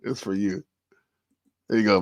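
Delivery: ordinary delivery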